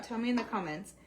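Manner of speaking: normal talking voice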